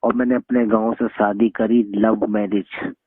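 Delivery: usual speaking voice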